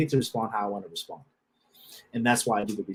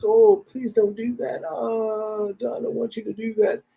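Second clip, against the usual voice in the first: much higher, whiny pleading imitation; mock begging; 12 semitones higher, slower than usual. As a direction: ham up a desperate plea for forgiveness. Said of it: "I'm gonna beg you for".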